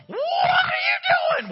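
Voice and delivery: very high, loud, slow panicked shriek; a hammed-up, stretched-out cry of alarm; mock alarmed shriek. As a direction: shriek it like a startled wife catching the dads on the roof. Wife catches them jumping off roof.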